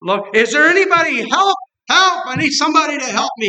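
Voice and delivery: high shouted cries for help, rushing; desperate yelling. Retold or imitated. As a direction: call out for help from a cliff. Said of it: "he's laying there yelling".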